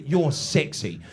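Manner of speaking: dry, sarcastic "You're sexy". Retold or imitated. British wife mocking her naked husband, sarcastic. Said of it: "I picked up on all the sarcasm".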